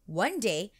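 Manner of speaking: ordinary delivery, nothing marked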